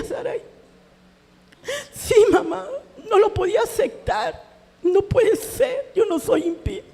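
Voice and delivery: high, crying dialogue with her daughter; mother crying to her daughter about being called impious; tearful. Real, not acted. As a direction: push the words out through sobs. Tearful exchange with daughter Saraí.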